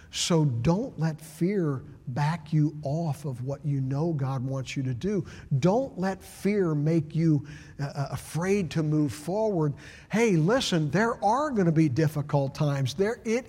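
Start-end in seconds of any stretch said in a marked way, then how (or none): none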